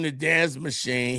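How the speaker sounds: ordinary talk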